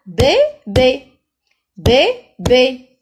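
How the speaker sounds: "bébé" slow and loud, bé-bé, gap between; two separate beats on "bébé"; syllable by syllable. Shown as a demonstration. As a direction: sound out "bébé" for pupils. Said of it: "Deux syllabes".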